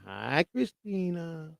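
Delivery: slow, soft drawn-out greeting in a put-on voice; Miss Kitty voice. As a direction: coo it like the AI woman. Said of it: "Miss Kitty said".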